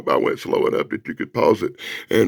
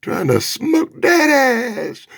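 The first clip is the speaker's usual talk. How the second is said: high, slow, strained exclamation; drawn-out over-the-top delivery; hammed-up menace; 14 semitones higher, much slower than usual. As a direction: stretch the words out for comic effect. Hammed-up demon attack.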